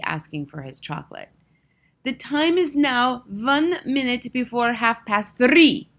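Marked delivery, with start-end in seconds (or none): 2.0-5.9 slow, loud, high proclamation; drawn-out, commanding witch announcement; announcing witch. A narrator's reading. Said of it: "announced the Grand High Witch".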